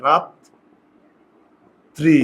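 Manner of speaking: রাত্রি split slowly into "raat... tri"; correct pronunciation. Shown as a demonstration. Teacher checks students understand the correct form, drawn-apart রাত্রি as "raat... tri". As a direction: stop long on the t of রাত্রি, then "tri". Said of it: "কিন্তু শুদ্ধ উচ্চারণ এটা".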